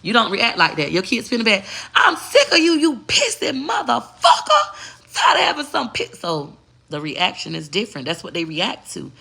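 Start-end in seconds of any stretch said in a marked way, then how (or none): 1.9-6.2 hammed-up rage at the pissy kid, laughing through it; fed-up parent yelling. Parent yells at child who wet the bed, mock angry yell.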